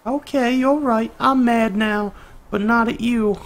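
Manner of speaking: loud, high falsetto called across the house; mad mom from afar. Acted. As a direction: holler that you're mad, like a distant mom. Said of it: "that's what her mom sounds like in the distance".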